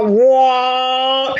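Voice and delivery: long, droning mock horn blast; imitated conch shell blast. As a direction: imitate a conch shell being blown with your voice. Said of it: "when you blew the".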